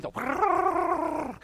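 high, sustained trilled whirr; cartoon scramble noise. Shown as a demonstration. Preacher mimicking cartoon feet fleeing a rattlesnake, cartoon scramble noise. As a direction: imitate cartoon feet spinning before takeoff. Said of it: "ever see the cartoons?".

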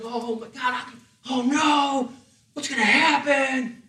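high, nervous mock panic. Preacher mimics Abraham's nervous panic, mock fretful. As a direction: play Abraham fretting, half joking.

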